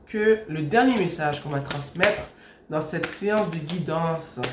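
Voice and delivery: normal talking voice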